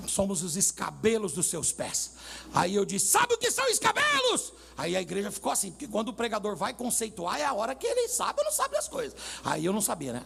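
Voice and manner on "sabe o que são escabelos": high-pitched mock pulpit cry; preacher's shout. Preacher quizzing congregation about footstools, re-enacted high shout. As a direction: re-enact a preacher crying out a question. Acts out his confident pulpit question.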